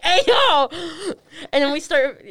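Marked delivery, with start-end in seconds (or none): 0.0-0.7 loud, high mock yell, voicing the dog; startled dog's protest. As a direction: yelp it out as the pestered dog. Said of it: "he's, he's like".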